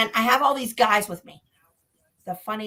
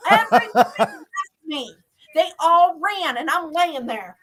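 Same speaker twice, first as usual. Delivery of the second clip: ordinary delivery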